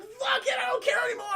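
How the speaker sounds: high-pitched frantic giving up; hammed-up distressed squeal; mock desperation. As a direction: wail that you're past caring. Acting blinded Newman giving up.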